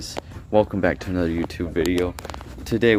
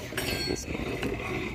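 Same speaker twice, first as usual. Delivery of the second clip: whispered; 11 dB quieter, much slower than usual. Pretend. Hushed repeat.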